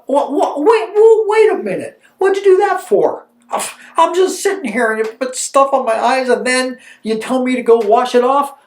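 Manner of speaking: high, loud mock protest; frustrated yelling. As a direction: act out an indignant, baffled complaint. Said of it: "being frustrated and yelling back at you".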